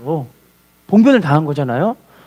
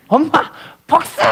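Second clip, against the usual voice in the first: high, acted cry for revenge; vengeful outcry; 8 semitones higher than usual. Wronged son on seeing his mother, vengeful outcry. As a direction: burst out in a higher, anguished voice.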